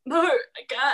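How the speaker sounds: retold tearful refusal; mock crying no. Refusing help after a knee to the chest, mock teary. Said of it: "I was, like, crying".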